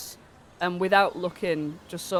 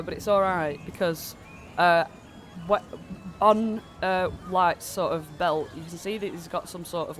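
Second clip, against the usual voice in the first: ordinary delivery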